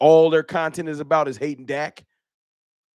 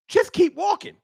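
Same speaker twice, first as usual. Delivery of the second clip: high dismissive; 14 semitones higher than usual. Acted. Dismissing the hater.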